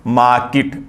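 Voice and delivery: मार्केट as 'maa-kit', long first vowel; correct pronunciation. Shown as a demonstration. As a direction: say मार्केट without R, ending 'kit'. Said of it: "sound बहुत long है". (मार्केट को 'माऽ-किट', 'र' के बिना)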